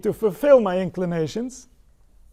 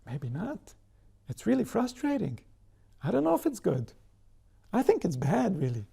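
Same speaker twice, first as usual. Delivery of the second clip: doubting inner voice; 4 dB quieter than usual. Pretend. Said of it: "what does he think?".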